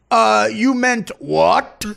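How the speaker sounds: drawn-out mock sarcasm, high, rising at the end; slow, loud sarcastic question that climbs on the last word; Matthew Perry. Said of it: "Notice how his voice goes up at the end".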